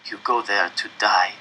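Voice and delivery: low, grim hoarse voice; hoarse and dry. A narrator's reading. Old man warning the boy, hoarse and dry. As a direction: say it low and heavy. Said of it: "his voice hoarse and dry".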